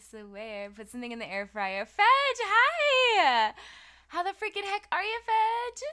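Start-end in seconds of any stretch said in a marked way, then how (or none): 1.9-3.5 very high, very loud, stretched-out hello; excited shouted greeting. Real, not acted. Streamer greets Fudge arriving in chat, high excited shout. 4.1-5.8 high, loud, fast gush; excited greeting. Real, not acted. Streamer asks Fudge how they are, high excited squeal. Greeting a viewer who arrives.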